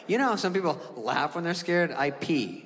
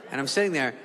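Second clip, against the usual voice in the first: normal talking voice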